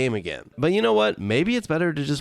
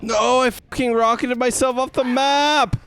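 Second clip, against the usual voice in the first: disbelieving yell; 11 semitones higher, 5 dB louder than usual. Real, not acted. Exasperated after blunder.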